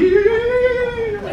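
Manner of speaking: high, fervent mock chant of yeahs; imitating Don praying. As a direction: squeal the yeahs up high like a churchwoman.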